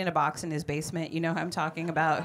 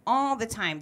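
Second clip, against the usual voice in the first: ordinary talk, nothing marked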